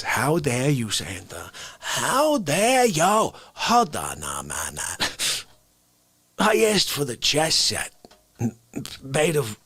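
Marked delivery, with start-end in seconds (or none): 0.0-1.5 low, indignant mock rant at Santa; mock outrage. Outraged at Santa over a present, mock indignant. 1.8-5.4 slow, drawn-out mock indignation; milk the fake offence slowly; hammed-up outrage. Ranting at Santa over a present, slow hammed-up outrage.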